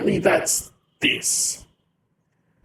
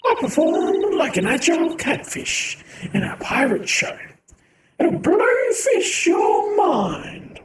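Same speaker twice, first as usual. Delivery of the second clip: hammed-up showgirl; 11 semitones higher, 4 dB louder than usual. Voicing punning performer.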